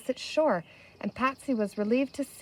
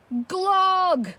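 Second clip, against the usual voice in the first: higher, louder word of dread; voice of doom; 8 semitones higher, 4 dB louder than usual. Acted. New man bringing dread news to the crowd, lightly voiced doom. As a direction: cry it out like a doom. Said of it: "in a voice of doom".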